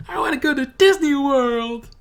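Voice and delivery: full-out high yell, drawn out; very loud, high-pitched mock cheer; imitated excited shout. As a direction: shout it like an overexcited cartoon character. Mimics Tristan's outburst from episode.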